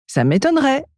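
high, small cheeky voice; defiant little elf. A narrator's reading. Elf answering the ogress's threat, high defiant voice. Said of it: "répond Mako".

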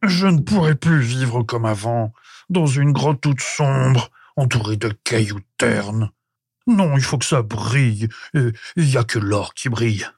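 caveman in a put-on, lamenting voice; confessing. A narrator's reading. Prehistoric man unable to live without shining gold, confessing. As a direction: confess it in character, drawing out the words. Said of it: "avoua l'homme préhistorique".

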